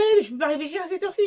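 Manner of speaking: mock husband whine. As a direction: rattle off his sleepy excuse. Imite la réponse du mari. (imite le mari qui râle, vite)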